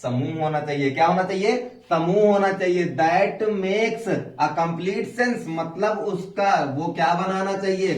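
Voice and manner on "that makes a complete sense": each word drawn out very slowly; slow stress. Shown as a demonstration. Teacher stressing the definition's second half, very slow. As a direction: lean on every word. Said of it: "stressing the definition".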